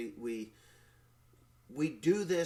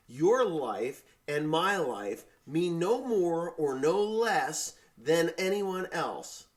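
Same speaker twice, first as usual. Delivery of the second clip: slow, louder, with swooping emphasis; emphatic declaration; 4 semitones higher, 5 dB louder, slower than usual. Real, not acted. Man telling a neighbor they are equals, slow emphatic declaration. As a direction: drive each phrase home slowly.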